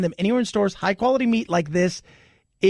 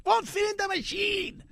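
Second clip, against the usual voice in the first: high, softer cartoonish echo; silly put-on voice; 12 semitones higher, 6 dB quieter than usual. Host echoing why the cow can't be sold, silly voice. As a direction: squeak it back in a comic voice.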